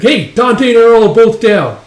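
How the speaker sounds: high, very loud report; panicked-sounding acted holler; alarmed shout. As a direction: yell the bad news across a battlefield. Shouts news of fallen men.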